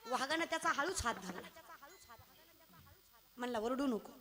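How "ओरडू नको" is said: a lightly voiced tiger, keeping it secret; low conspiratorial aside; tiger confiding. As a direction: lower hushed tiger voice. Tiger whispers to bear.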